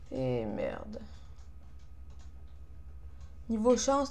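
ordinary delivery

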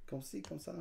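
soft put-on French accent; understated fake French; weird French voice. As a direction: toss it off quietly like a mock Frenchman. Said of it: "you get to talk in a weird voice".

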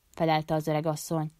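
usual speaking voice

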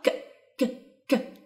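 "ك" as a short isolated k; k sound demonstrated. Shown as a demonstration. Teacher showing how C K is pronounced, isolated k sound. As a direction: click out a bare k for "ك". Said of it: "بتتنطق".

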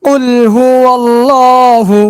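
tajweed recitation voice; Quranic recitation. Shown as a demonstration. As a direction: recite it joined, drawn out and melodic. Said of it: "এবার একসঙ্গে উচ্চারণ করব".

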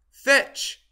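loud, high crowed command; crowing rooster. A narrator's reading. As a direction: crow it out sharply to send a dog running. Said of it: "he crowed".

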